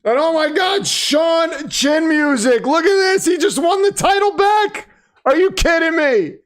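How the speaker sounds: high-pitched, astonished commentator call; stunned excitement. Real, not acted. Commentator reacting to the title being won back, stunned excitement. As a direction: call it like a shock upset.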